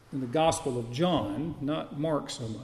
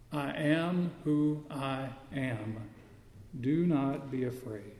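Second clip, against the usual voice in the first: slow, hushed divine declaration; solemn reassurance; 2 semitones lower, 6 dB quieter, slower than usual. Acted. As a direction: quote it softly and slowly, giving each word weight. Preacher voices Jesus revealing himself.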